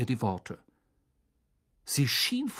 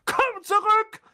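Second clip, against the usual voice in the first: high, loud barked order; barking; 5 dB louder, slower than usual. A narrator's reading. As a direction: bark it after him. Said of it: "bellte er".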